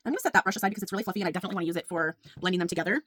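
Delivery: usual speaking voice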